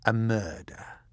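understated, savoured delivery; relishing the word. A narrator's reading. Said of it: "relishing the sound of the word".

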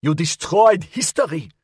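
high, outraged outburst; shouted accusation. A narrator's reading. Custine at the inspector over the wrecked bass, shouting. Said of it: "Custine shouted at the inspector".

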